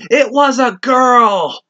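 high, loud, slow cheerful announcement; doctor calling out from offstage, high and slow; doctor announcing from offstage. Retold or imitated. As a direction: call it out like a proud doctor offstage. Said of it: "you just hear the doctor from off stage going".

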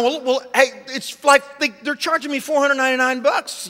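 high, sputtering self-defence; mock-exasperated excuse; flustered protest. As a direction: plead your case with stumbling starts. Retold flustered protest over bill.

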